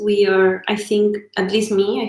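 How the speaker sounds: ordinary talk, nothing marked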